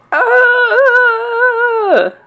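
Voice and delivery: sudden shrill-free high yelp of fright; high startled scream. A narrator's reading. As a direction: shriek in a very high voice. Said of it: "The man yelled".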